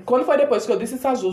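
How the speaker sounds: ordinary talk, nothing marked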